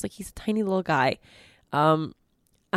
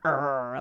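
dog growl; 7 semitones lower, 6 dB quieter than usual. Retold or imitated. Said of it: "like, goes, like".

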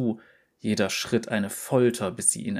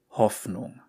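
ordinary delivery

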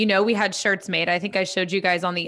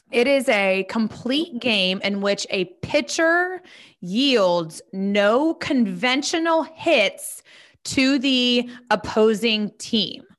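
ordinary talk

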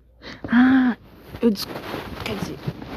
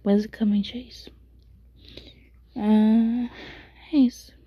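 whispering. Real, not acted. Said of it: "eu tô sussurrando porque eu tô com sono".

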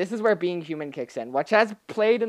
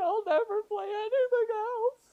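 very high, sobbing voice; hammed-up tearful farewell; mock crying; 6 dB louder than usual. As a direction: blubber it through fake tears. Amethyst breaks down crying.

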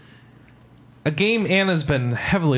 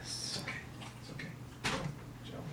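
soft whisper; 21 dB quieter than usual. Real, not acted. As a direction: soothe the cat in a whisper. Soothing the startled cat.

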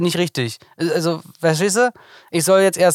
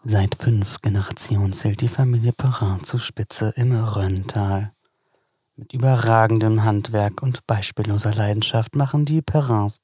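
low, deliberate advertising read; wine ad read; 5 semitones lower than usual. Acted. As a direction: read it like a sommelier's catalogue. Reads wine description aloud.

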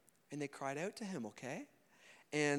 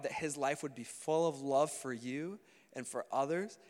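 ordinary talk, nothing marked

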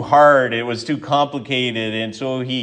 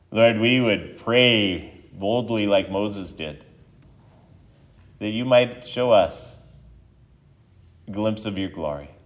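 pastor's prayer; 2 semitones lower, 4 dB quieter than usual. Acted. Pastor leads prayer before sermon.

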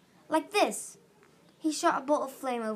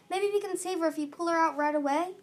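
higher, hopeful urging; urgent yell; 6 semitones higher, 3 dB louder, faster than usual. A narrator's reading. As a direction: press them to act at once. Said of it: "he yelled".

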